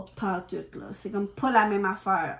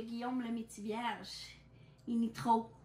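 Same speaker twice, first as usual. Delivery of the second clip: ordinary talk, nothing marked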